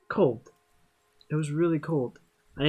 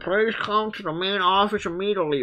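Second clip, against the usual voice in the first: higher-pitched tannoy announcement voice; PA announcer; 4 semitones higher, 3 dB louder than usual. Acted. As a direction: call it out like a school intercom. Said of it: "an announcement echoed through the cafeteria".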